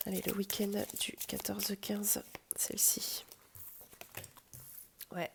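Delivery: soft unvoiced whisper. Real, not acted. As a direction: whisper to the deck. Whispering while drawing cards.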